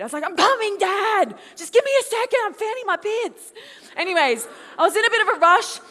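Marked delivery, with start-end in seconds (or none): 0.2-3.5 high, loud bratty retort; acted-out petulant yell; brat mode. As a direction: whine back at dad like a stroppy teenager. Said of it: "revert to a bit of brat mode".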